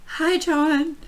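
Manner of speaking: high, giddy fan greeting; starstruck fangirl. Retold or imitated. Fan told to use the singer's first name, starstruck. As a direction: squeak out a giddy hello. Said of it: "fangirl, like, to the max".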